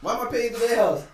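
high, loud disbelief; incredulous protest. Real, not acted. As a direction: fire back as if the idea is absurd. Mock outrage at listener's question.

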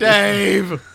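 loud drawn-out hype call of the name; crowd-pumping shout of Dave. Acted. Hyping Chappelle Show again.

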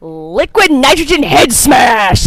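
slow, hammed-up shout; gleeful announcement. Co-host naming her favorite kill, gleeful mock announcement. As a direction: draw it out like a big title, building to the last word.

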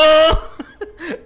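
big high burst of laughter; hearty open laugh; loud laugh. Real, not acted. Laughs at own joke.

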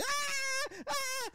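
very high mock wails; imitated screams of pain. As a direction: wail twice like burned victims, hammed-up. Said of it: "They get this".

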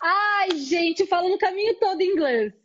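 loud, high burst of amused disbelief; amused exclamation. Real, not acted. Host reacting to friend's Portuguese-speaker punchline, loud amused exclamation. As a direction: exclaim it loudly over the punchline.

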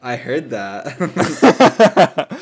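slow, loud and laughing; cracking up while talking. Real, not acted. Reacts to a fart, laughing.

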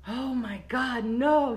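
drawn-out mock dismay; mildly hammed-up horrified father; imitated dismayed dad. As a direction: groan it like an appalled dad. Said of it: "He went like that".

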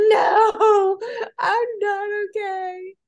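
high, teary crying reply. Retold or imitated. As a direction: sob it out, half laughing through tears. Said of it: "I'm like".

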